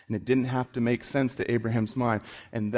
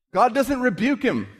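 high, loud, fast fervent preaching; thundering preacher at the climax; peak preaching; 10 semitones higher, 6 dB louder than usual. Acted. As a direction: drive it home at full volume.

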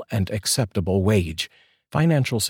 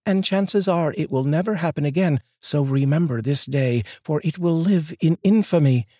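high voice, grandly declared; wife mock-grandly declaring to husband; mock proclamation; 9 semitones higher than usual. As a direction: pronounce it like a famous speech.

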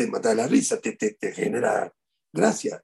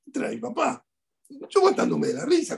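normal talking voice

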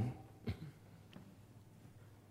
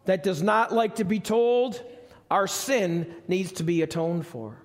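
higher and louder with each in a world; building sermon cry; 9 semitones higher, 5 dB louder than usual. Acted. Preacher rallying the congregation, rising to a fervent cry. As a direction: climb into a full preaching roar.

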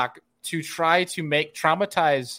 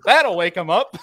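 higher, louder smug quip; cocky quip; 4 semitones higher, 6 dB louder than usual. Retold or imitated. Andy gloating after dinging the pontoon boat. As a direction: toss it off with a grin. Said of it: "he goes".

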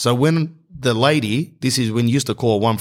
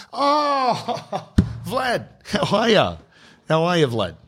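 high-pitched, drawn-out "oh" of recognition; hammed-up delighted surprise, pitched way up; sudden recognition; 10 semitones higher, much slower than usual. Boss finally remembering the caller, exaggerated surprise.

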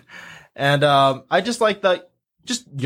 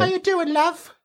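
much higher, camp British greeting; British accent. Pretend. Friend answering love of British accents, high British flirt.

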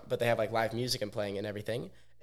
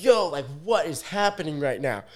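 loud, higher-pitched mock alarm; retold disbelief; 5 semitones higher, 5 dB louder than usual. Friend seeing others get choked out, loud imitated disbelief. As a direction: cry out in acted bewilderment.

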